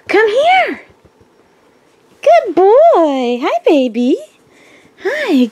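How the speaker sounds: high, loud sing-song calling of the dog; cheerful high dog-owner voice, drawn out. Real, not acted. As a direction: coo at your pup like a doting owner. Calling and cooing to her dogs.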